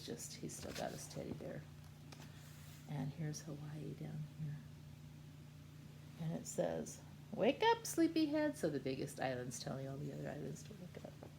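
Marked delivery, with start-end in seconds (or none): 0.0-1.6 whispering. Real, not acted. Hushed aside about picture. 7.3-8.6 sing-song call. Acted. Hawaii waking islands.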